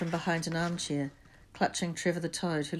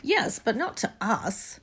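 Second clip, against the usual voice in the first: slow, higher exasperated retort; 5 semitones higher, 4 dB louder, slower than usual. A narrator's reading. Ron answering Neville's protest, exasperated. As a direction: drag it out in exasperation. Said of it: "said Ron in exasperation".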